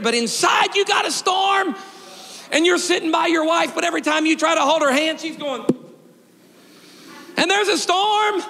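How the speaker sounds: high, ringing preacher's call; full-force preaching. Acted. Pastor preaching to his congregation about hidden storms, full force. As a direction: lift the room like a revival preacher.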